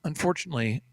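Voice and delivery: hushed, whispered grim news; fake sentimental anchor. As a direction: drop to a mock solemn whisper. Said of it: "The news guy goes".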